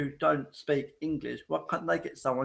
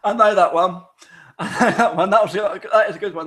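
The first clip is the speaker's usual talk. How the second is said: excited laugh; 4 semitones higher, 10 dB louder than usual. Real, not acted. Recognises the joke, laughing loudly.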